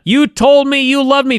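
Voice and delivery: hammed-up heartbroken yell at her; mock scream. Liv screaming at Lana in the ring, a mock yell. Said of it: "starts screaming at Lana".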